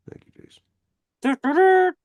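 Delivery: squeaky, stretched-out high thank you; a drawn falsetto thanks; silly high voice. Pretend. Streamer thanking chatter Jason, in a stretched falsetto.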